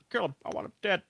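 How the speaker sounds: a hammed-up, unplaceable accent as George; odd George accent. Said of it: "I don't know what, what accent that was".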